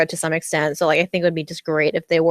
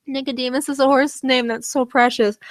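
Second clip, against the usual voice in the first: higher-pitched, tickled gush; emotional gushing; 6 semitones higher than usual. Real, not acted. Telling viewers again about the precious horse name, emotional. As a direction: dwell fondly on it, voice lifted. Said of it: "I don't know why I'm so emotional".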